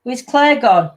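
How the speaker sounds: ordinary delivery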